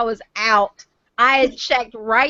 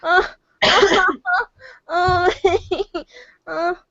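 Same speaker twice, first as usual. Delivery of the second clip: crying laughing; 7 semitones higher than usual. Real, not acted. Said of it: "I'm crying".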